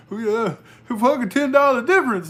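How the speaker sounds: high, loud mocking imitation of a cheapskate friend; mocking Big Easy. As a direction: ham up the stingy buddy brushing it off. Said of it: "He's like".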